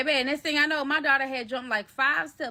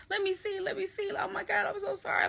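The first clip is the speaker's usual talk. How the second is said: high-pitched, softer panicky pleading; retold panic; 9 semitones higher, 5 dB quieter than usual. Checking the bleeding housemate's leg, high mock panic. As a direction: fret over the injury in a high, small voice. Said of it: "Like, I'm panicking".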